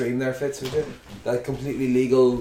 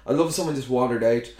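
normal talking voice

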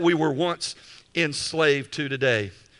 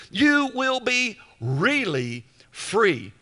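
"You will be really free" drawn out slowly, pitched up; emphatic stress; 4 semitones higher, much slower than usual. Shown as a demonstration. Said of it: "It's emphatic".

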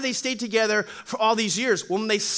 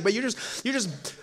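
ordinary delivery

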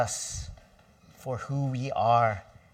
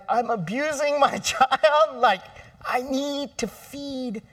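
mock anguish; 12 semitones higher, slower than usual. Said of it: "your heart breaks. You're like".

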